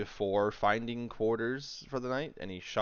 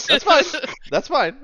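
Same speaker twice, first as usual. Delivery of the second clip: higher, louder laughing reassurance; 15 dB louder than usual. Real, not acted. GM laughing at player.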